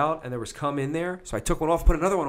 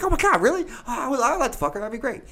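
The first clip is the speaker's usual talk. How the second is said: put-on much higher, breathless eager teen; giddy excitement; 11 semitones higher, 4 dB louder, faster than usual. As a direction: squeal with disbelieving joy. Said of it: "I'm like".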